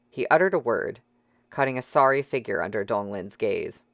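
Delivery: ordinary delivery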